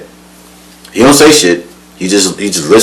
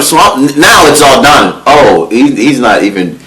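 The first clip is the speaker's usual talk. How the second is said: ordinary delivery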